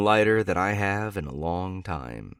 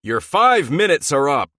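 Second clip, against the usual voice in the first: much higher, much louder desperate yell; Ben yelling; 8 semitones higher, 12 dB louder than usual. A narrator's reading. Teammate yells into the bathroom, desperate and loud. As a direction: yell it through the door. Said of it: "Ben yells into the bathroom".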